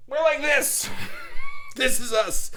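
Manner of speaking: high, loud laughing shout; excited shout. Shown as a demonstration. Vlogger showing viewers their wild energy, shouting and laughing.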